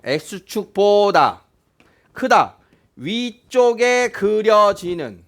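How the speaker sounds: slow, chopped into phrases with long pauses; deliberate decoding. Shown as a demonstration. Teacher decoding an inequality for students, slow and deliberate. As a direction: stretch each phrase out for the class.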